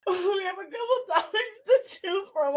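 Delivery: high giggling, words barely held together; trying to talk through a fit of laughter; cracking up while reading the topics; laughing through words. Real, not acted. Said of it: "I'm sorry. That video makes me laugh".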